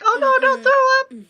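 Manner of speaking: high, loud alarmed cry; urgent panicked plea. Real, not acted. Sim about to vomit.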